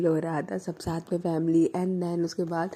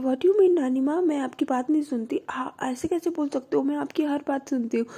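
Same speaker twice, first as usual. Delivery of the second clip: raised, indignant mock complaint, higher pitch; half-playful outrage at grandma; mildly angry protest; 7 semitones higher than usual. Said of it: "[name] को गुस्सा आती है". (हल्का सा नाराज़, ऊँची आवाज़ में शिकायत)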